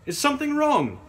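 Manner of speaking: loud, high call to the driver. A narrator's reading. Lady calling the driver from the coach window, loud. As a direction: call out across the road. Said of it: "I called out".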